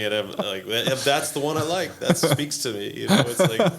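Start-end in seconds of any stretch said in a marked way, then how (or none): 0.7-2.9 higher, louder, slow mock-reverent gush; hammed-up wonder, higher and slower; mock solemn awe. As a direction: gush over the verse like it's profound. Mock earnest reaction to verse.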